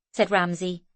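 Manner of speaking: plain reading voice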